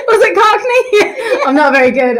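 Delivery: laughing. Real, not acted. Teacher laughing at own impression.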